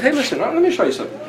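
eager guide's beckoning aside; confiding tour guide. Acted. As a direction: draw them in, fast and high. Voices Yahweh as tour guide.

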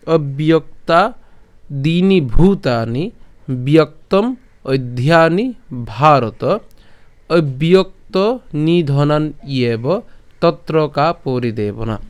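slow, careful spoken Sanskrit verse; plain spoken recitation. Shown as a demonstration. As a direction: recite each word slowly and clearly. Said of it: "বলছি, তাহলে কেমন হবে".

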